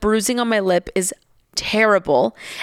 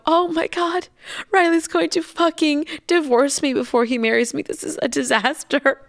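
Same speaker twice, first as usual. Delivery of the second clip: higher-pitched dramatic dismay; retold panic; 6 semitones higher than usual. Bride-to-be dreading her fiancé's reaction, retold panic. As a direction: wail it as a mock catastrophe.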